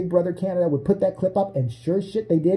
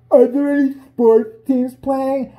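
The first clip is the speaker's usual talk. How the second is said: slow, higher and louder dopey whine; mock dumb question; 9 semitones higher, 6 dB louder, much slower than usual. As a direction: stretch it out like a clueless jock. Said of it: "the first question I would ask".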